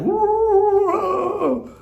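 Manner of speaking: mock ugly-cry wail, very high; a hammed-up sobbing howl. Jokingly imitates the ugly cry he avoided, mock wail. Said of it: "that ugly cry".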